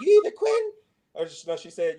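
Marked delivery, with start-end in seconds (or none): 0.0-0.7 high, loud mock mom ultimatum; high loud mom imitation; mom's voice. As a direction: hit it high like mom laying down the law.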